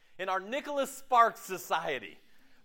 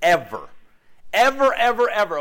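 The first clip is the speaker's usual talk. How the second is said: emphatic shout; 10 dB louder than usual. Real, not acted. Preacher hammering a point.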